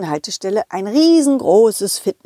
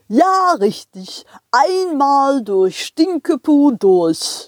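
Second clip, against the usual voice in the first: slow, higher friendly driver; train driver voice; 4 semitones higher, much slower than usual. Acted. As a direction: speak as the jolly driver.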